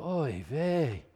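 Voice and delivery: a resigned, quiet oy vey; weary oy vey. Retold or imitated. Preacher sighing at believers who trust TV news, resigned. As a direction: shrug it off with a tired sigh of oy vey. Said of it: "and I just go".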